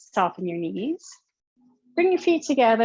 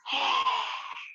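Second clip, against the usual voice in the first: lion's breath exhale; 3 semitones higher, 6 dB quieter than usual. Shown as a demonstration. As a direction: force the air out with a roar.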